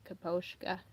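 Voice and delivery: "Kaposhka" with a long "oh"; kuh-POHSH-kuh. Retold or imitated. Fan repeating the creator's version, "Kaposhka" as kuh-POHSH-kuh. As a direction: round the middle of "Kaposhka" to POHSH. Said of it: "he said it".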